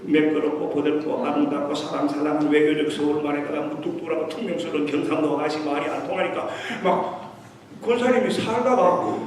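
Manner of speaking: normal talking voice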